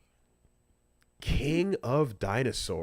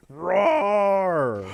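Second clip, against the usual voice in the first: mock dinosaur roar; 7 semitones higher, 5 dB louder than usual. Co-caster answers with dinosaur noise.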